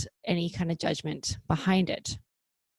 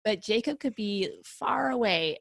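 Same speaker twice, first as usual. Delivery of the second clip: usual speaking voice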